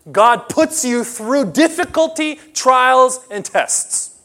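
loud, high, slow preaching climax; preaching at full force. Acted. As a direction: preach it out to the congregation. Preacher raises voice on suffering.

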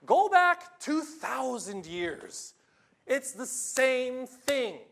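a slow, emphatic preaching build; preaching climax. Acted. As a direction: drive the point home hard. Sermon climax.

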